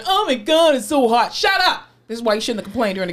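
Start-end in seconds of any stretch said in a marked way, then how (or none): 0.1-1.3 high, loud mock complaint; mocking complainers. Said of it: "then you go". 1.3-1.9 high, loud snap back; mock exasperation. Snapping back at imagined complainers.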